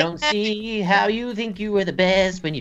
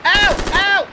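pained yelp; 11 semitones higher, 5 dB louder than usual. Real, not acted. Hit by gunfire in game.

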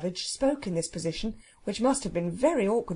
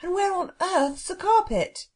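high, demanding call; exasperated shout; 10 semitones higher, 3 dB louder than usual. Acted. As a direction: call out the question in a raised pitch. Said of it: "Robert shouted".

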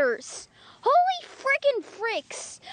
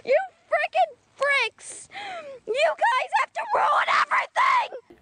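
mock angry yell; 4 semitones higher than usual. Angry rant at fans.

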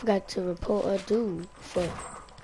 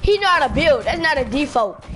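raised, loud indignant complaint; louder, higher outraged gamer; 5 semitones higher, 6 dB louder than usual. Real, not acted. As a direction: protest it like it's unfair. Indignant complaint about opponent building.

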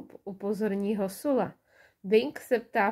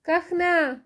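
high, louder cry; excited shout; 7 semitones higher, 5 dB louder than usual. A narrator's reading. Bing spots the duck and shouts, high and loud. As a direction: call it out with delight. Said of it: "vykřikne Bing".

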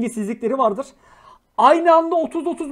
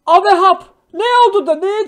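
loud, indignant blurt; loud, indignant cry; outraged exclamation; 6 semitones higher, 9 dB louder than usual. Real, not acted. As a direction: bark it at the screen. Outraged exclamation at chat story.

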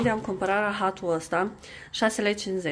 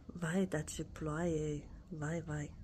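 slow, soft poem line, much quieter; slow, soft nursery rhyme line; hushed recital; 5 semitones lower, 11 dB quieter, much slower than usual. Shown as a demonstration. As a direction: hush the words and draw them out for the child. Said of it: "Repetă după mine".

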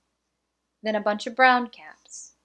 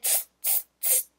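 soft unvoiced teasing tss; monkey tsk sound; 11 dB quieter than usual. Monkeys mocking the peddler, soft voiceless tss. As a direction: hiss back like cheeky monkeys. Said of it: "and said".

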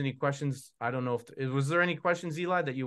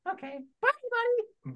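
high squeaky puppet goodbye; Char Char farewell. Acted. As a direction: wave off the audience in a squeak. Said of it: "Hey, Char Char".

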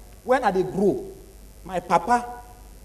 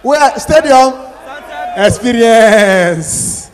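drawn-out loud call; mock bewildered shout; slow shout; 7 dB louder, much slower than usual. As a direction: stretch the words out at full voice. Shouting in a retold scene.

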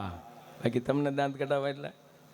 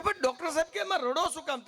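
mock surprise; 12 semitones higher than usual. Patient asks crying doctor.

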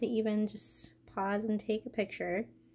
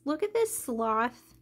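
normal talking voice

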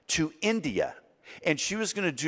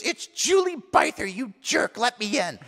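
high, put-on outraged girl; mock an angry girl demanding entry; indignant mimicked girlfriend; 6 semitones higher than usual. Drenched girlfriend at the door, mock indignant.

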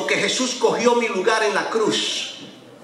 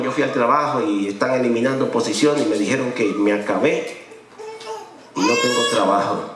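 much lower, calm businessman voice; philosophical businessman; 10 semitones lower than usual. Retold or imitated. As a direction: play it cool and worldly, like a financier. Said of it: "yo bien filosófico, bien businessman".

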